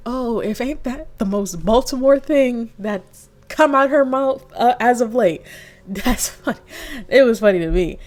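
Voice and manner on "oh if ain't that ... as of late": mock amazement. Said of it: "And I'm like".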